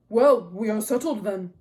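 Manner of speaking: very loud, conclusive king's voice; Agamemnon, brisk commander. A narrator's reading. Agamemnon recovering before watching men, loud and conclusive. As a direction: declare the matter closed, loudly.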